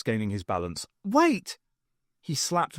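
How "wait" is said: cry. A narrator's reading. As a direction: shout to stop them. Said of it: "cried Stokes".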